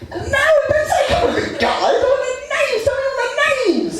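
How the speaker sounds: put-on very high, pushy girlfriend voice; insistent girlfriend, pitched far up; demanding girlfriend. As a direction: squeak out her demands.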